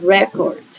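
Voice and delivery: "record" stressed on the first syllable, REC-ord. Shown as a demonstration. Teacher contrasting noun-verb pairs, "record" stressed on first syllable. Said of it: "There's a difference".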